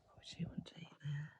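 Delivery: quick whispered aside; whispered question. Real, not acted. Parent whispers a question to the listening child. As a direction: ask it softly and fast.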